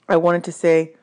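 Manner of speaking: ordinary delivery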